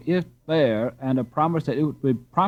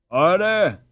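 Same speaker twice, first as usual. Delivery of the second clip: louder call to order; cried order; 7 semitones higher, 6 dB louder than usual. A narrator's reading. Captain calling noisy boys to order, loud cry. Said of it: "cried the captain".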